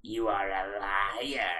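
low, slow devilish accusation; Mephisto. Acted. Devil rejecting Jeffy's answer, low slow tempter's voice. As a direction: draw it out deep and soft. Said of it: "I'll do it in Mephisto voice".

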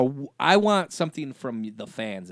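ordinary talk, nothing marked

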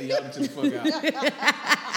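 slow, high, laughing plea. Real, not acted. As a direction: beg them to chill, laughing.

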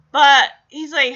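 usual speaking voice

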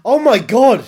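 loud, high, shocked cry; startled exclamation. Acted. Newcomer waking to bodies, startled shout. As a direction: exclaim it in big mock shock.

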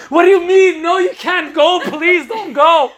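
cling and beg her not to leave; desperate pleading. Acted. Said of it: "his clingy plea to partner".

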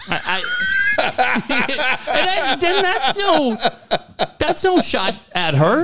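high, laughing through the words; real laughter breaking in. Real, not acted. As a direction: laugh while trying to finish. Breaks up laughing mid-sentence.

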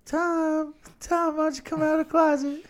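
high, fast teasing sing-song call; mock call to Tom. Teasingly calling out Tom Cruise as if he could hear, high sing-song. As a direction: call out to him playfully.

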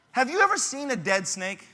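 ordinary talk

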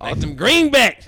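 high, loud hammed-up shout; loud mock voice. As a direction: belt it out in a silly voice. Repeats it much louder.

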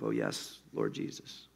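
barely voiced murmur to the Lord; hushed prayer. A narrator's reading. Corrie in the dark barracks, praying in a near-silent murmur. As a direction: breathe it under your breath.

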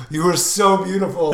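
loud, high gushing adoration; overcome with emotion. Retold or imitated. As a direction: gush as if about to cry. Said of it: "I about cried".

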